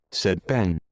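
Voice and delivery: ordinary delivery, nothing marked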